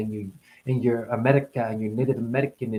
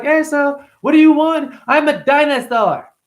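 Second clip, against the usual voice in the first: put-on little kid voice; 13 semitones higher, 7 dB louder than usual. Retelling the kid dialogue.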